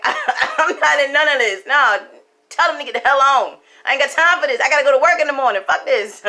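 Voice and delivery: loud, high, fed-up brush-off with a laugh; dismissive fiance. Retold or imitated. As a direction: wave the whole thing away.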